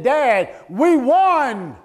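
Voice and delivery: loud, high cry of victory; son's joyful shout. Retold or imitated. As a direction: burst out with the win. Son running up, overjoyed.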